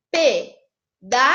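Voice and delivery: "Pe-da-so" in three slow, clear syllables; syllabified slowly. Shown as a demonstration. Teacher correcting the exercise with students, slow syllables. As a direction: spell out pedaço by syllables. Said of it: "Será que foi certo?".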